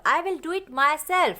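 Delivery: slow sentence with myself leaned on; teacher stressing myself in the example. Shown as a demonstration. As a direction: land the weight on the word myself. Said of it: "emphasise word use करें".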